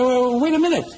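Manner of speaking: high, hurried comic astonishment; sudden backtracking. Acted. Priest suddenly hears of a big donation, eager backtracking.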